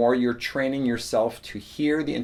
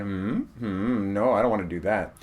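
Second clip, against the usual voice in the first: lightly imitating a reluctant student; low, doubtful hum, then quick refusal; mock reluctance; 4 semitones lower, 3 dB quieter than usual. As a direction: hem and haw, then decline. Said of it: "they always look at me".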